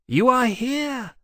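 high, drawn-out cry of joy; joyful cry. A narrator's reading. Temeraire greeting Maximus on his arrival, joyful. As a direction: greet a friend with delight, pitched right up. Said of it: "Temeraire cried joyfully".

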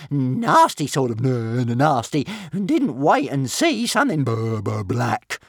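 loud, slow stuttering little dragon; frightened stammer. A narrator's reading. Dragon describing the nasty thing, stammering in fright. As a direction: stutter on the first sounds in fright. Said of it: "stammered Toothless".

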